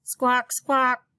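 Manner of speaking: high, loud squawking parrot; shrill bird call. Acted. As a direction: screech it like a parrot. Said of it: "all Paco did was squawk".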